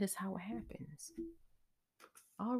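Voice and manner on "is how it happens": unvoiced hushed aside; whisper. Real, not acted. Reader to her client, a whispered wrap-up. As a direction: let the point drop to a whisper. Tarot reader ends explanation softly.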